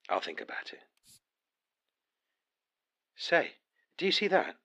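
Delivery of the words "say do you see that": high, loud startled question; sudden surprise. Acted. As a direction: catch sight of something, alarmed. Spots a flash of light.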